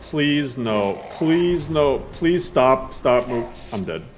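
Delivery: ordinary delivery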